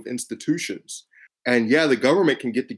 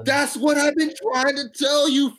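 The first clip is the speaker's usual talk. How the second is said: laughing exasperation. Retold or imitated. Exasperated retort to grandmother, laughing.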